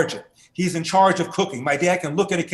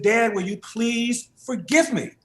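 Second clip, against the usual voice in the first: slow, higher, choked-up plea to his father; tearful plea; 6 semitones higher, slower than usual. Retold or imitated. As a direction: ask forgiveness through tears, slowly. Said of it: "And now I'm crying".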